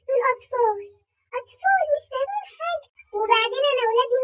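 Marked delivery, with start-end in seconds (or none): 1.4-2.9 tearful. Acted. Aunt defending her kids.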